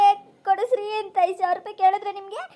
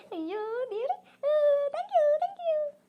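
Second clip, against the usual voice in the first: much higher, laughing-crying thanks; mock tearful joy; 9 semitones higher, much slower than usual. Wife thanking god for the money, laughing and crying.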